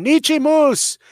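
high, loud call, stretched out slow; retold yelling. Man at his truck hollering to his wife, high calls. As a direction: holler the name across the lot, waving. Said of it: "he started screaming".

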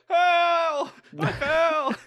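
high, loud comic cry for help; cartoonish plea from a hole; mock trapped gravedigger. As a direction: ham up a stuck man yelling.

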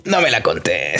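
lightly Argentine No me la contés, low and soft; a hint of Argentine accent, mumbled low. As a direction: slip into a joking Argentine tone. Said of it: "se me sale el argentino".

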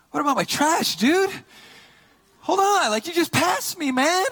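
high-pitched, loud retold protest; mock indignation. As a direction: yell after the trash guy in comic outrage. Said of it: "I'm mad".

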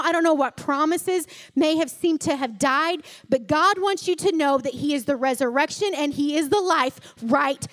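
loud, fervent preaching; full-force sermon climax. Real, not acted. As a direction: drive it home to the congregation.

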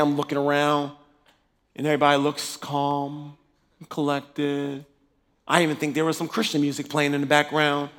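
usual speaking voice

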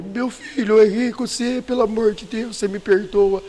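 lightly voiced father begging through tears; retold tearful plea. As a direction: beg forgiveness like a tearful old father. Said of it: "Aí ele chorando igual, ele".

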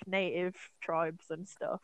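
ordinary talk

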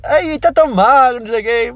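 high, loud mock relative commenting on weight; lightly voiced nagging aunt, quick and bright in pitch; a hint of a relative fussing over you being thin; pushy relative. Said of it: "aquele que é tipo".

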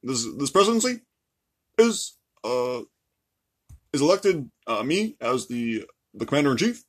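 halting presidential impression with long pauses; measured statesman, stopping and starting on fillers; stately, hesitant politician cadence; Barack Obama. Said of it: "I could do an Obama accent".

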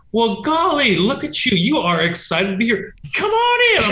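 high, chirpy, hammed-up folksy officer; mock folksy customs officer. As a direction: wave the visitor in with over-the-top cheer. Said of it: "And they're just like".

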